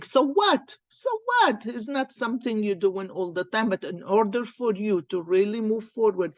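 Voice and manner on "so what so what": higher, defiant shrug; a defiant so what. Pretend. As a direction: toss it off boldly. Voicing defiance to move on.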